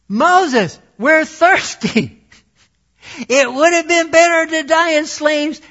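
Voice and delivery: high, whining mock complaint; whiny complainer. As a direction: whine it like a spoiled child. Mocking whining Israelites.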